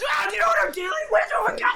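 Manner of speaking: high fast panicked shout at the cop; hammed-up freaking-out driver; frantic yell. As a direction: lose it on the officer, shrill and rushed.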